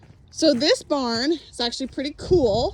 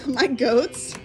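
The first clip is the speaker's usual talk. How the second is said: ordinary talk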